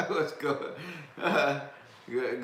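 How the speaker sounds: a high laugh breaking into a greeting. Real, not acted. Streamer laughing as he greets his live chat, high-pitched. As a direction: laugh through the hello.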